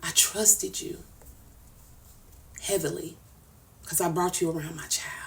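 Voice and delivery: barely holding it together, voice sinking to a whisper; hurt, breaking down. Real, not acted. Telling Nitra she hurt her, in a hushed, hurt voice. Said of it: "I get emotional".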